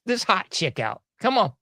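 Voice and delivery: normal talking voice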